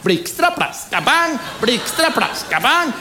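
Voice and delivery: high, rhythmic sound calls; lightly playful storm chant. Acted. Acting out the storm.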